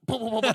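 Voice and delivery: loud, high, rapid booms; imitated gunfire. Cops shoot them both, comic imitated gunfire. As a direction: fire off booms through laughter.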